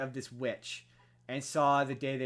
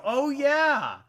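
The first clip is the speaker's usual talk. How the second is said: higher, louder oh yeah, as if it just dawned; mock realization; 12 semitones higher, 9 dB louder than usual. Telling a friend how Depp's roles reminded her, mock surprised. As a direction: play the sudden remembering. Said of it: "made me go".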